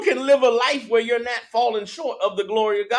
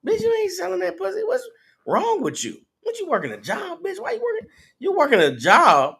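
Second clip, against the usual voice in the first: higher-pitched taunting pimp voice; preacher voicing a pimp recruiting a woman, higher and mocking; pimp talk; 7 semitones higher than usual. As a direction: scoff at her for working a job, pimp-style. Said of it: "That's how they talk".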